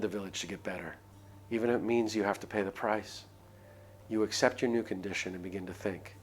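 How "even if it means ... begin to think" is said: ordinary talk, nothing marked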